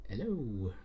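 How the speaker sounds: usual speaking voice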